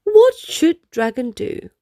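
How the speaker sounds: plain reading voice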